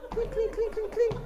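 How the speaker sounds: very high, rapid clinks; glass clinking imitation. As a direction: squeak out a run of tiny clinks. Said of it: "you hear the glass".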